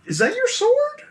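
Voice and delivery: squeaky high suspicious cop; high officer voice. Acted. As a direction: ask it in a thin high voice. GM voices officer questioning player.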